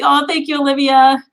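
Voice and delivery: usual speaking voice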